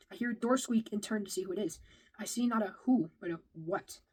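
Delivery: ordinary delivery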